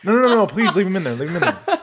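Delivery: high, loud, rushed mock begging; hammed-up alarm; panicked pleading. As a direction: plead frantically, playing it for laughs. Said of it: "I'm like the adults".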